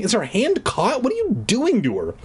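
mild mock scream, pitched high. Mother questioning the puppeteer, mild screaming. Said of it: "Alicia's mother was screaming".

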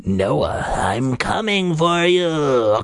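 slow gruff monster call; gruff and low. Acted. Masked sister calling to her brother, gruff and menacing. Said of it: "her voice came out gruff and low".

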